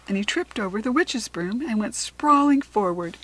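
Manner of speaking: ordinary delivery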